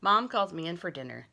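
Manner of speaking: plain reading voice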